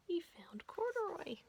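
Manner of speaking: hushed whisper. A narrator's reading. Watchman finds bear, whispered.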